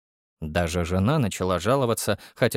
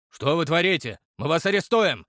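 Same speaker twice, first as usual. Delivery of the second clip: startled; 5 semitones higher than usual. Acted. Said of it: "спохватился Михалыч".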